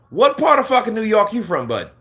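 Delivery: incredulous challenge. Acted. Mocking a fake New Yorker.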